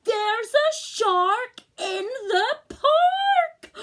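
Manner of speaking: very high, very loud slow shout; child shrieking a warning; yelling with all his might. Acted. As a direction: yell with everything. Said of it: "Timothy yells with all his might".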